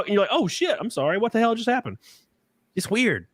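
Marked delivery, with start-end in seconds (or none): 0.3-2.0 higher-pitched mock bewilderment; flustered retold reaction, pitched up; acted startled worker. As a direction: play a clerk taken aback by abuse. Acts out startled retail worker.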